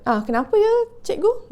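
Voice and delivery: retold puzzled question, mild surprise rising on "cikgu"; mildly surprised father. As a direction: imitate a taken-aback father, pitch jumping up at the end. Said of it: "father ni terkejut". (bapa agak terkejut)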